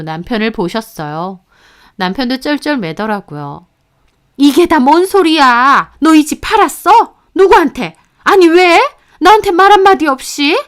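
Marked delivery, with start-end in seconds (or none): 4.4-10.6 higher, louder, mother grilling her son; furious interrogation. A narrator's reading. Mother confronting son about selling the house, furious. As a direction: fire questions at your son in outrage.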